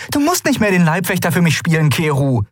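high, hurried insistence; heated retort. A narrator's reading. Pilot telling her bodyguard to stop guarding her, heated. Endriel argues with Keru.

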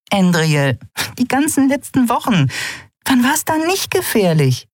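high-pitched, exasperated character voice; pointed retort. A narrator's reading. Friend reminding pilot of weeks of danger, exasperated. Nelen counters Endriel's warning.

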